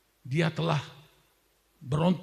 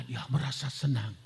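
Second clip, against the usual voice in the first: normal talking voice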